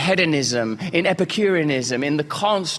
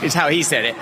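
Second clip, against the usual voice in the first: usual speaking voice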